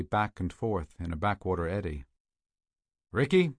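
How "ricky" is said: loud call across the water. A narrator's reading. Peters calling to his missing partner, loud call. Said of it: "Peters called out".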